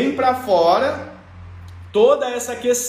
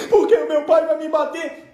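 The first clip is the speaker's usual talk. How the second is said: crying Chiquinha; 6 semitones higher than usual. Acted. Chiquinha fearing her dad's beating, high mock bawling. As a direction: whine it out in mock tears. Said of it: "ela já vem chorando".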